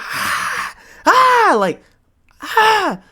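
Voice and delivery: high loud screams; pillow scream. Retold or imitated. Reenacting heartbroken screaming into his pillow, high loud wails. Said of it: "I'm like".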